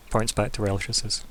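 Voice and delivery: ordinary talk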